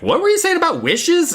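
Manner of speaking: very high, loud rising question; sudden curious mom. Retold or imitated. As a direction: snap back to the girl, suddenly interested. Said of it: "She's like".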